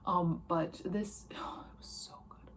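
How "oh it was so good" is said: breathy, reverent whisper about a book she loves; awed whisper. Real, not acted. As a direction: hush it, as if the book still gives you chills. Gushing over favourite book.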